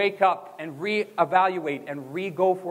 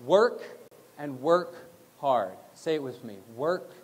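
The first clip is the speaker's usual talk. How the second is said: ordinary talk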